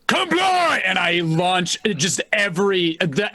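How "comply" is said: high, sudden barked order; shouted command. Acted. Ordering the crowd before firing into the sky, shouted command. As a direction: bellow it at the crowd.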